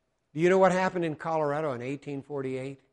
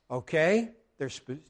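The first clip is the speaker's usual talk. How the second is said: ordinary talk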